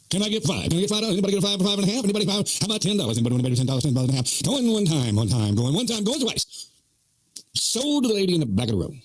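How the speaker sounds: high, chanting auctioneer patter; mock auctioneer. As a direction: rattle off bids in a play auction sing-song. Plays auctioneer taking donation bids.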